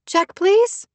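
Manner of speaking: very high, polite sing-out; high-pitched, pleasant request; pleasant call. A narrator's reading. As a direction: call sweetly to a server, way up high. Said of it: "called pleasantly".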